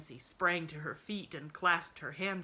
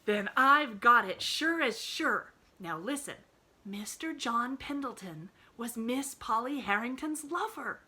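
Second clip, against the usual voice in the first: impressive announcement; 7 semitones higher, 9 dB louder than usual. Acted. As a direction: announce it grandly. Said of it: "she announced impressively".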